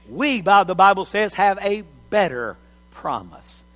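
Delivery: usual speaking voice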